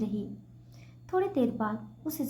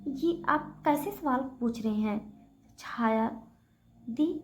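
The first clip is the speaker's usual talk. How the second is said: ordinary delivery, nothing marked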